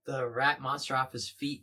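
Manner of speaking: ordinary talk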